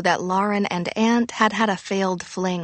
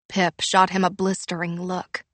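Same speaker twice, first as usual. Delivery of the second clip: usual speaking voice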